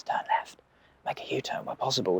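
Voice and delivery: whispered sat nav directions; sat nav on low volume. Acted. Sat nav lady helping out on volume two, whispered. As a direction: whisper it softly like secret directions. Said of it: "kept the lady on, like, volume two".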